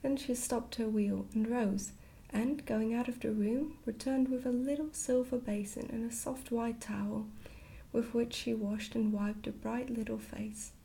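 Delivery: ordinary delivery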